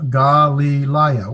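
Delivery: Galileah drawn out slowly; careful pronunciation. Shown as a demonstration. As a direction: stretch each syllable of Galileah. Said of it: "It's pronounced with the".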